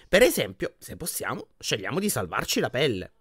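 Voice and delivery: high, loud, swooping sarcastic lecture; haughty portrait. A narrator's reading. Portrait lecturing Harry on courage, haughty and sarcastic. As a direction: lecture with mocking superiority.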